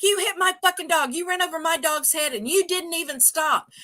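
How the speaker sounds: high angry accusing voice; angry yell. Retold or imitated. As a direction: shout the accusation like a stranger. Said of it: "He's like".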